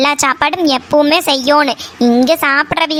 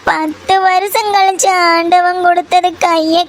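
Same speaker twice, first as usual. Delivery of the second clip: grieving wail. Acted. Daughter mourning the long-awaited baby, grieving wail. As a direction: draw out each phrase end in grief.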